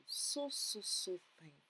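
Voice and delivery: overcome with thanks. Real, not acted. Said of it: "I was just so thankful".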